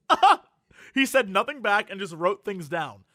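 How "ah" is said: sudden high, loud ah like the streamer; a quick startled ah, mimicked; imitating a blurted ah. Retelling the silent streamer's odd reply, a mimicked high ah. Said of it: "and he was like".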